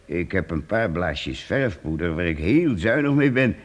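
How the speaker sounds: ordinary talk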